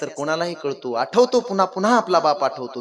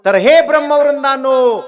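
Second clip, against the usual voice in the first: high, ringing call to an assembly; father's loud appeal; 8 semitones higher, 8 dB louder than usual. Acted. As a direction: call out forcefully to the judges. Father calls on the Brahmins.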